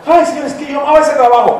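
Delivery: high mock whine about the lost job; whining lament. Worker crying to God over old job, mock whine.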